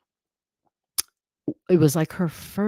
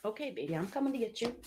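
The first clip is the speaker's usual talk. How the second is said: high, singsong coaxing from across the room; pet talk; 8 semitones higher, 6 dB quieter than usual. Real, not acted. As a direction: sweet-talk a pet in, much higher than usual. Calling her pet in at door.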